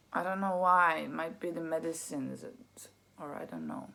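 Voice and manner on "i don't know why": normal talking voice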